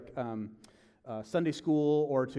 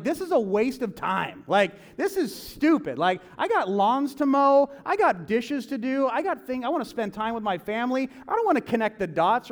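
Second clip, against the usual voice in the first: exasperated whining; frustrated grumbling; 6 semitones higher, 5 dB louder than usual. Retold or imitated. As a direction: vent about wasted time. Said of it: "I'm like".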